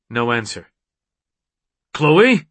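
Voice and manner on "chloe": raised, louder questioning call. A narrator's reading. Man calling for his missing mate, questioning shout. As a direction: call her name, voice rising.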